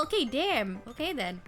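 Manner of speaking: ordinary delivery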